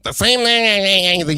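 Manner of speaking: slow nasal impression of a young pundit; nasal mimicry of a rival guest; nasal Nick Fuentes voice. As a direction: drag it out through the nose. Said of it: "He talks like this, the nasally voice".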